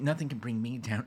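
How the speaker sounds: ordinary talk, nothing marked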